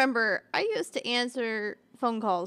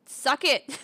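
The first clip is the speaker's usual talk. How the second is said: loud, higher-pitched comeback; cheeky retold retort; mock defiant retort; 5 semitones higher, 7 dB louder than usual. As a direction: fire back, playfully defiant. Retold retort to shushing coworkers.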